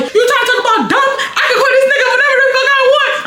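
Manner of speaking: high-pitched, heated mock yell; retold yelling. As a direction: yell a challenge with the voice way up. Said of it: "I'm just yelling at the car".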